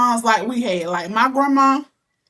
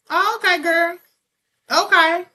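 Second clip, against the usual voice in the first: higher-pitched, placating okay; retold backing down; 7 semitones higher than usual. Daughter giving in to mom's threat, backing down. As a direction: back down in a raised pitch. Retold meek reply to mother.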